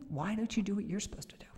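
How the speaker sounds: whispered rebuke, unvoiced and soft. Holy Spirit whispering to the speaker to get on with it. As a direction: hush it like a secret correction.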